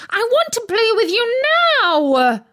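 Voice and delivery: high, loud demanding shriek; a small girl shrieking for attention. A narrator's reading. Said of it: "shrieked Debbie".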